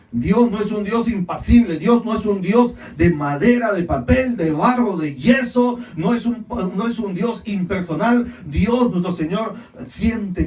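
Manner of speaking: forceful, rolling sermon delivery; impassioned preaching. Real, not acted. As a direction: hammer out the list with conviction.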